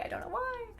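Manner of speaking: very high, soft repeat; puzzled high echo. Real, not acted. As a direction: let it float up small and baffled.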